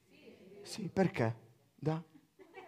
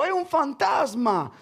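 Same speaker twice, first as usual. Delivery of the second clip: slow, higher cry of fright from the disciples; frightened cry; 10 semitones higher, 6 dB louder, slower than usual. Acted. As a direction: cry out as if seeing a ghost. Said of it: "E dalla paura gridarono".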